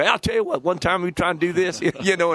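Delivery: mock Southern football coach accent; Southern drawl imitation. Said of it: "Got a little Southern to him".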